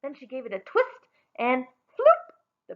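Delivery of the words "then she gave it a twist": ordinary delivery, nothing marked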